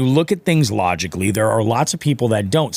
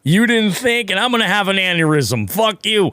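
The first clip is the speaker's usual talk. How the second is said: high, exaggerated fake-friendly voice turning sarcastic; sweet on top, fed up underneath; mock polite reply; 7 semitones higher than usual. To the boater at the ramp, hammed-up fake politeness. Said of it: "I was like".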